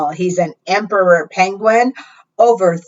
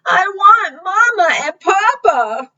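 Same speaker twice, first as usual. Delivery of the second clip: wailing chick; 12 semitones higher, 3 dB louder than usual. A narrator's reading. Lost little Pip wailing for her parents, high and tearful. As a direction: wail it like a tired, lost child. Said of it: "she wailed".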